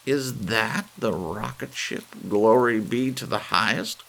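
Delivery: put-on old lady appalled at the shabby rocket; appalled cry. A narrator's reading. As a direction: cry out in shocked disbelief. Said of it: "cried Missus Bellows, appalled".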